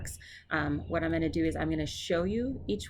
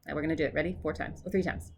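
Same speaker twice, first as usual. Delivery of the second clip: ordinary talk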